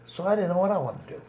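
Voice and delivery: ordinary delivery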